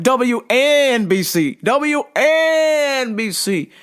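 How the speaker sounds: high, slow, stretched-out holler; mock announcer call. Grappler showing he can still talk in a choke, high drawn-out mock broadcast call. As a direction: draw the letters out like a station call.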